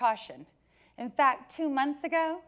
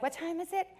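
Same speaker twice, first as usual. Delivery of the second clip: put-on excited kid; 7 semitones higher, 4 dB quieter than usual. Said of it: "He was excited".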